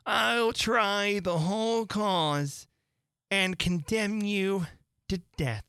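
put-on cunning old Fury. A narrator's reading. Cunning Fury passes sentence, slow and relishing each word. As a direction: stretch out the cunning threat. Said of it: "said cunning old Fury".

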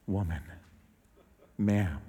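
low, soft, respectful address; gentle dignity. Shown as a demonstration. Preacher shows Jesus addressing the woman, soft gentle respect. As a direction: say it gently, as to your own mom. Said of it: "do you think he said it like this".

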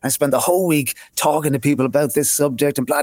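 usual speaking voice

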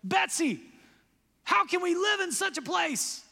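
high, loud pleading cry; distressed plea. Acted. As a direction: cry out to her sister in dismay. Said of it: "Corey says".

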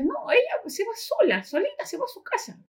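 put-on high, pushy child's voice, rising to a squeak; a kid tugging his dad to leave, high and insistent; impatient little boy. Boy urging his father to walk away, high impatient child voice. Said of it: "Entonces dice".

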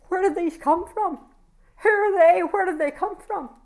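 high, wondering cry of amazement; amazed questions. Retold or imitated. Preacher voicing a woman amazed at children she never expected, high and wondering. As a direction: marvel out loud at where they came from. Quoting prophecy of found children.

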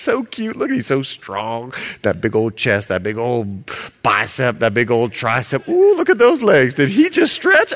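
gushing over his muscles; mock infatuated swoon; lovestruck bride. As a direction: drool over him, giddy. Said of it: "I just love you".

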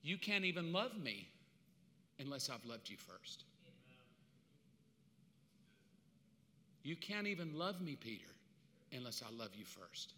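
acted as Jesus gently explaining, with long pauses; tender correction. Jesus tenderly answering Peter.

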